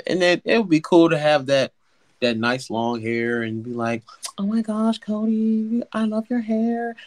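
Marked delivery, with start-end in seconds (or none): 4.4-6.9 gushing admirer voice. Retold or imitated. Admirer gushing over his hair.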